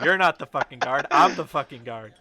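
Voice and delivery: loud, laughing protest; laughing outburst. Real, not acted. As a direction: shout it while cracking up. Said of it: "I'm playing your N P Cs now?".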